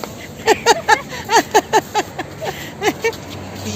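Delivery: a long, high burst of real laughter. Real, not acted. Laughing high after her family drags her to the car. Caught outside, teased by family.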